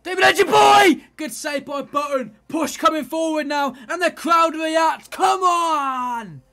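high, loud roaring commentary; excited shout. Real, not acted. Commentator screaming as his team pushes forward. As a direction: ride the crowd's roar. Chance and save, crowd reacts.

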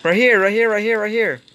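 high, run-together repeated callout; urgent callout. Real, not acted. Player directing a teammate, urgent high callout.

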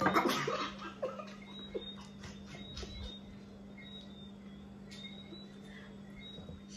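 wheezing laugh. Real, not acted. Laughing fit.

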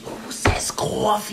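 slow, hushed Jersey "coffee"; exaggerated Jersey accent. Shown as a demonstration. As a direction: draw out "coffee" with a Jersey vowel. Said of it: "I'll catch myself saying coffee".

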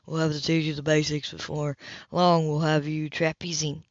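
a hint of a relaxed sailor teaching her; Cam's voice. A narrator's reading. Male sailor voiced lower.

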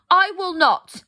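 loud, high defiant refusal; said bravely. A narrator's reading. Kay defying the Snow Queen, loud brave refusal. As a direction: stand firm against the queen. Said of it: "Kay said bravely".

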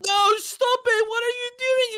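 distressed yelling. Real, not acted. Boy watching tiger wreck his base, distressed yelling. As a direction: wail at the intruder to stop. Victim yells as base is destroyed.

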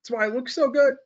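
mocking contrarian economist. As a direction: ham up a pompous know-it-all. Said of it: "now I'm going to make fun of him".

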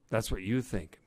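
ordinary delivery, nothing marked

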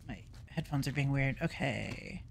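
ordinary delivery

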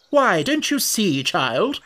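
high, drawn-out exasperation; impatient scolding. A narrator's reading. Red Queen to Alice, impatiently scolding.

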